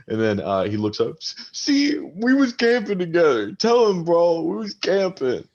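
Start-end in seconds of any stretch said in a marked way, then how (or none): 1.2-5.5 put-on high, slurring drunk arguing with his buddy; drunk quarrel. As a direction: snap at your drunk friend to calm down. Drunk Jacob pleads to player.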